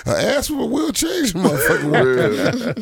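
cackling punchline; laughing proclamation. Retold or imitated. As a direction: declare it through laughter. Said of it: "my first thing was".

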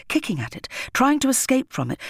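ordinary delivery

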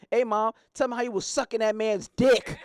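put-on high, loud little girl voice. Girl blurting to her mom in loud child voice. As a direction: pipe up like a nosy child. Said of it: "a little girl said".

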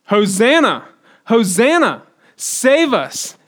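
loud, high crowd cry of praise; joyful shout from the crowd. Retold or imitated. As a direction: call it out like the cheering crowd. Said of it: "what are the people shouting".

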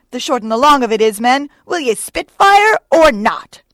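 loud, high exhortation to a boat crew; urgent, bellowed rallying cries; Starbuck. A narrator's reading. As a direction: goad the rowers with rising shouts. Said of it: "cried Starbuck to his crew".

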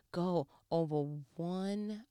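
ordinary talk, nothing marked

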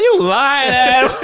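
loud, high, laughing call-out; mock accusation. Calling out the boy's boast to mom, loud mock accusation. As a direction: shout it through laughter, high and teasing.

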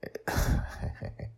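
breathy quiet chuckle; little private chuckle; soft laugh. Real, not acted. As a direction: laugh under your breath. Host laughs softly.

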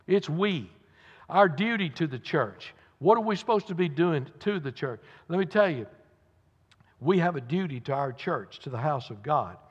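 normal talking voice

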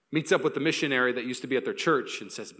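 normal talking voice

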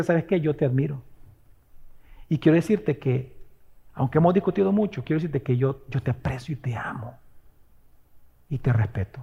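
fading from soft to a whisper; tender confession. Retold or imitated. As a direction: let the love come out gently. Reconciling words, softening to whisper. (a la persona con la que pelea, suave hasta susurrar)

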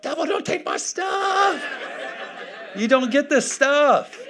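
put-on high-pitched, drawn-out childlike shout; defiant yell. Preacher models believers confronting the devil, defiant high yell. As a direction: yell it slowly at a thief, like the little boy. Models yelling back like his son.

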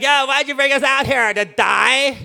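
high, loud, drawn-out whine; hammed-up griping, stretched at the end; mock complaining. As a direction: complain like an aggrieved crowd. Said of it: "they're complaining to Moses".

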